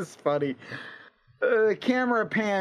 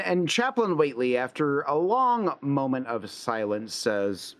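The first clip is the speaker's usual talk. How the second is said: ordinary delivery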